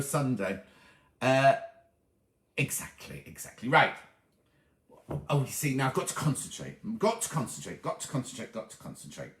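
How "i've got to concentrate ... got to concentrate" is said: muttering to herself. Real, not acted. Muttering to herself while concentrating.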